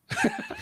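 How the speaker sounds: amused burst of laughter. Real, not acted. Interviewer reacting to a lot of money, laughing. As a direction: laugh at the big figure.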